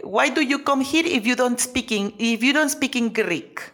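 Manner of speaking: slow, retold broken English with a Greek accent. Young woman relays the shouting vendor's words in a slow Greek accent. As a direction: mimic the lady's English slowly. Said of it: "Me dice".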